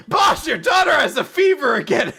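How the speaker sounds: high-pitched alarmed messenger; urgent announcement. Retold or imitated. Employee breaking news to the boss, urgent. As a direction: blurt out bad news in mock alarm.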